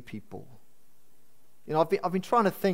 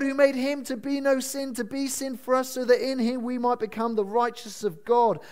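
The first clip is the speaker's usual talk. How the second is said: raised, high-pitched, urgent sermon voice; impassioned preaching; 7 semitones higher than usual. Real, not acted. Preacher urging the church to share the gospel, impassioned preaching. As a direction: press the message home with mounting fervour.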